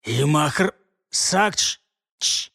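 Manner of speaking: slow sputtering choked sounds; sputtering, choking. Acted. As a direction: spit and choke on words. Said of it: "плевался он".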